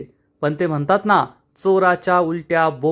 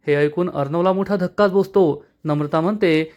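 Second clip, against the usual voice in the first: usual speaking voice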